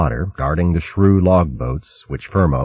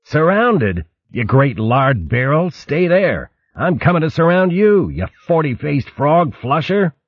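mock insults; 9 semitones higher than usual. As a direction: hurl cheerful insults. Gonf mock-insulting friend.

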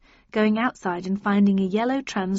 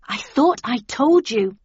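annoyed; 4 semitones higher, 6 dB louder, slower than usual. A narrator's reading. Narrator annoyed at door.

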